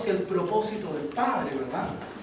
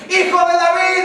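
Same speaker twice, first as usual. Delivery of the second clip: high, loud shout; crying out; 11 semitones higher, 11 dB louder than usual. Retold or imitated. Blind man seizes his one chance, crying out. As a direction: shout over those silencing him. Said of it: "esos gritos eran".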